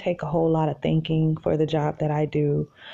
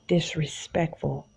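hushed, emphatic whisper; 4 dB quieter than usual. Real, not acted. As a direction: whisper the word. Emphatic confession.

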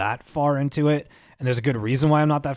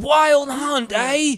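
much higher, louder mock British "Wild Hunt, eh?"; mock British accent; 14 semitones higher, 5 dB louder, much slower than usual. Host teasing the British co-host by mocking his accent. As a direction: ham up a silly British accent on the subtitle. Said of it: "butchering my accent".

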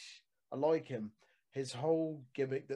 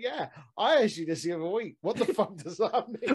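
mock exasperation; 7 semitones higher, 8 dB louder than usual. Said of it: "he's just like".

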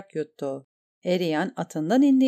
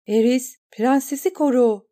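higher, louder battle command; shouted order; 6 semitones higher, 5 dB louder than usual. A narrator's reading. Eriyen orders Eris to guard the princess, shouting. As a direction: bark the order across the deck.

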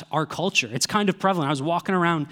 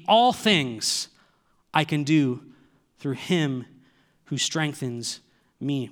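ALL THINGS lifted up front, rest settling down slower; emphatic all things; 2 semitones lower than usual. Shown as a demonstration. Preacher showing the congregation the emphatic word order, stressing All things. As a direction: lean on All things, then let the line fall. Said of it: "If we were to translate this literally".

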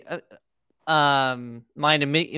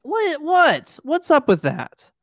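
much higher disbelieving what-what; a hammed-up what-what, pitched way up; mock incredulous; 9 semitones higher, slower than usual. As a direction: throw up your hands in disbelief. Incredulous rant at Uncle Gobi.

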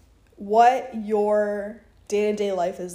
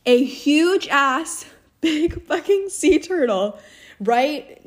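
excited; 5 semitones higher, 5 dB louder, slower than usual. Real, not acted. Retelling a startling sea turtle.

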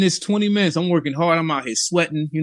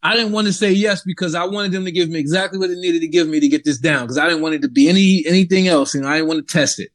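ordinary talk, nothing marked

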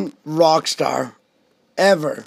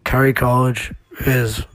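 lower, even shout-out read; announcer read; 5 semitones lower, 5 dB quieter than usual. Acted. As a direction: read the list like a host. Reads birthday shout-outs.

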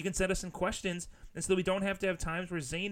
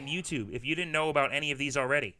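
podcast plug; 7 dB louder than usual. Acted. Podcast plug over co-host.

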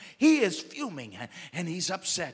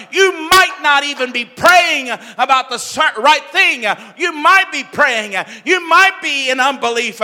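climbing louder and higher, full force; preaching crescendo; 8 semitones higher, 13 dB louder than usual. Acted. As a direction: build the sermon to a roar. Sermon building to full force.

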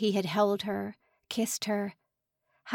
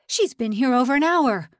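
raging; 4 semitones higher, 8 dB louder than usual. Acted. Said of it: "Lang raged".

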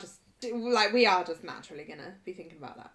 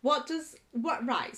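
ordinary delivery

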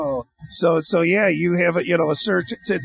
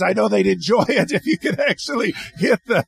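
chuckling through the words; laughing. Real, not acted. Host teasing the singer about hitting the right notes, laughing. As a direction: laugh as you tease him about the notes.

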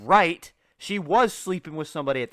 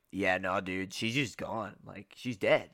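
low, soft offhand dude; casual dude voice; 9 semitones lower, 6 dB quieter than usual. Retold or imitated. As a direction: shrug it off like a bro. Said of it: "he was like".

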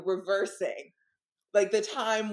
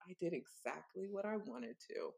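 much softer, calm and sinister; quiet menacing reply to the friend; slow quiet menace; 13 dB quieter than usual. Retold or imitated. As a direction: say it softly and coldly. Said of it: "like, very slowly".

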